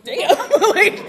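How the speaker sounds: sudden loud mock-stunned "Damn". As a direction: blurt it out, floored. Retold reaction to sponsor's question.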